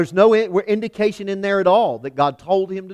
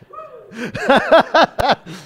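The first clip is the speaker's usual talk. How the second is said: a real high laugh bursting out; high burst of laughter; 4 dB louder than usual. Real, not acted. Preacher to congregation, laughing at his own joke. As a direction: laugh hard at the punchline.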